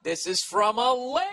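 high, loud mock gasp of surprise; hammed-up amazement at a lady writing in; mock shock.

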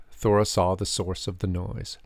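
usual speaking voice

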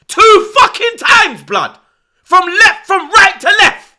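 raging fan shouting at the telly; furious shouting. Real, not acted. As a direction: bellow at the defenders in disbelief. Furious rant at defending.

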